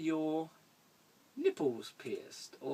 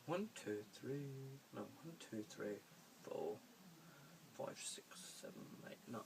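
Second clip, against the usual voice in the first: counting under the breath, much softer; whispered counting; 15 dB quieter than usual. Real, not acted. Recounting figures under breath.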